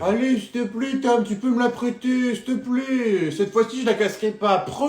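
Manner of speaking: put-on high pleading kid voice; whiny child begging. Little brother begging Tom for the controller, high and whiny.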